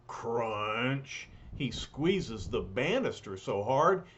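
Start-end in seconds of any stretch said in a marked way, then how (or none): 0.1-1.3 crunch sound effect. Acted. Banister breaks sound.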